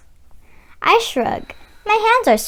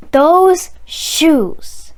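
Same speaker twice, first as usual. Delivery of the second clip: longing awe; 3 semitones higher, 4 dB louder than usual. Acted. Child longing for the shoes.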